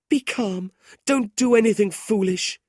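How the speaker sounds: high, urgent soothing; pleading sister. A narrator's reading. Kate urgently begs her brother to be calm. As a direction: beg him to stay calm.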